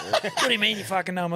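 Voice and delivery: high, loud mock challenge; imitated incredulity. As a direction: play the disbelieving mate, voice shooting up. Disbelieving friend challenges him, acted.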